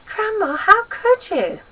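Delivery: high, slow horrified plea; boy horrified by grandma, high pleading; appalled boy. A narrator's reading. George appalled.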